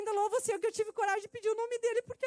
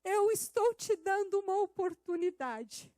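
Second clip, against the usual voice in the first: preaching at full force; 5 dB louder, slower than usual. Acted. Preaching God's words.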